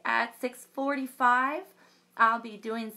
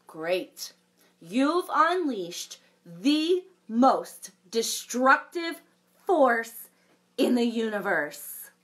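lively storybook read-aloud with big reactions; reading to children; 2 semitones higher, 6 dB louder than usual. A narrator's reading. Librarian reading a unicorn story to children, animated. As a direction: read it like a picture book for kids.

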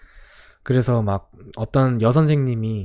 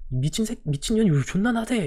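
mock angry cursing; 8 semitones higher, 3 dB quieter than usual. Student cursing teacher again.

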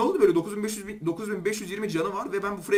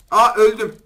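startled; 10 dB louder, slower than usual. Real, not acted. Sudden hit in game.

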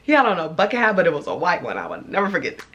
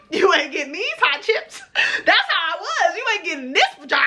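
higher-pitched mock refusal through laughter; laughing refusal; 8 semitones higher than usual. Teller refusing a begging man like a kid, laughing. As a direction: giggle while turning them down.

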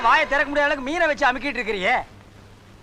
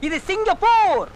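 high, slow stress on Singapore; emphatic announcement; 5 semitones higher, 3 dB louder, slower than usual. Acted. Lecturing his companion on life abroad, emphatic announcement. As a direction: draw Singapore out slowly, pitched high.

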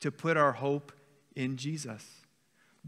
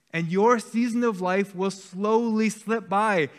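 higher, louder urgent preaching voice; full-force preaching; 4 semitones higher, 6 dB louder than usual. Acted. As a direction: preach it out, pressing the church not to wait.

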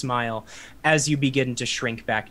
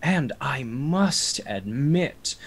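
cold vampire lord; 3 semitones higher, 5 dB louder, slower than usual. Acted. DM voicing Strahd.

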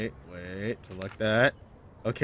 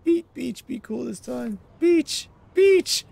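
pitched way up, whining in disbelief; exasperated high whine. Real, not acted. Gamer whining at a puzzle clue, pitched high in exasperation. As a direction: squeal it in exasperation.

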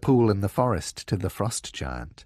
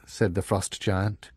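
ordinary delivery, nothing marked

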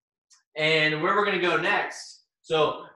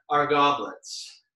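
ordinary talk, nothing marked

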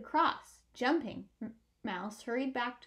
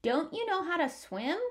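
gravelly voice; 6 semitones higher than usual. A narrator's reading. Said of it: "called a gravelly voice".

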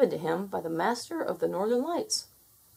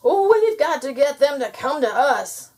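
high, loud gnome voice; chattering gnome; 7 semitones higher, 7 dB louder than usual. A narrator's reading. Freezing gnome to otter, chattering. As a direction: call it out high, as if chattering with cold. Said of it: "chattered the gnome".

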